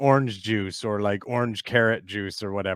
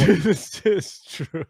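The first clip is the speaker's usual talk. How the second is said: laughing; 5 semitones higher, much slower than usual. Real, not acted. Laughing agreement.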